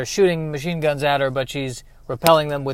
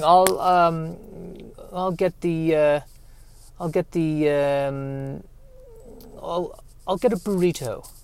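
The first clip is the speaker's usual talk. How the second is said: a lightly voiced, fading bad [name] impression, softer; 6 dB quieter, slower than usual. Comic impression of a Beatle fetching a burrito, deliberately bad. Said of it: "that is the worst impression".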